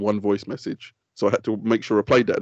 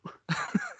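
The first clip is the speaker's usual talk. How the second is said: high squeaky laugh; 10 dB quieter than usual. Real, not acted. Laugh after admitting fault.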